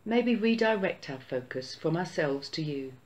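ordinary talk, nothing marked

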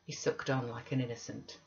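usual speaking voice

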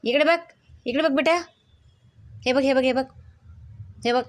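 coaxing call. Real, not acted. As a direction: coax the dog to look over here. Calling dog to look. (कुत्रीला उंच लाडिक हाक)

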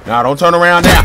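high, loud urgent cry; excited shout. Real, not acted. Pleading with enemy in game.

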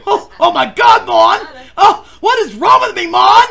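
high, loud hammed-up wail; overacted crying fit; mock wailing. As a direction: melodramatic fake sob. Mock sobbing over a movie.

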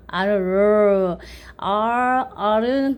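"R" drawn out, tongue off the palate; R sound demo. Shown as a demonstration. Teacher says tongue mustn't touch palate, open English R. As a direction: hold "R" without the tongue touching the roof. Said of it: "혀가 입천장에 닿으면 안 돼요".

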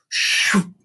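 quick voiceless "shoo" whoosh; sharp rushing noise made with the mouth. A narrator's reading. Reader imitates something flying at Furley, sudden whoosh. Sound effect in the story.

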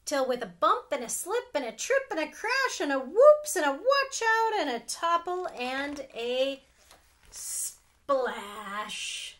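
loud, rushing storybook climax; building story reading. A narrator's reading. Aunt reading the big splash, loud and building. Aunt reads picture book aloud.

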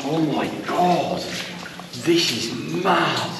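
slow, drawn-out wonder; real amazement. Real, not acted. Explorer stepping into the mine, awestruck. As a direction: stretch the words in astonishment.

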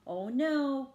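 ordinary delivery, nothing marked

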